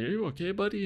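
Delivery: high-pitched mock concern; trying to sound worried. Host checking on the guest he just hit, half-hearted worry. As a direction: put on concern while secretly amused. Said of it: "I'm trying to be worried".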